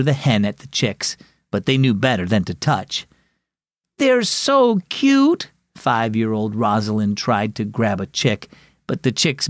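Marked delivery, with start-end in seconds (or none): 4.0-5.5 put-on high, slow, cooing child voice; little girl's adoring squeal of words. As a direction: gush over something cute, drawn out and high. Small girl adores the chicks.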